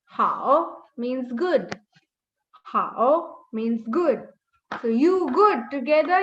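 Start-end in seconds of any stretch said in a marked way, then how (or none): 0.0-0.8 "Hao" drawn out slowly, dipping then rising; toned model pronunciation. Shown as a demonstration. Teacher explaining the word, careful dipping "Hao". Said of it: "Let's read". 2.6-3.4 "Hao" drawn out slowly, dipping then rising; toned model pronunciation. Shown as a demonstration. Teacher explaining the word, careful dipping "Hao". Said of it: "Let's read".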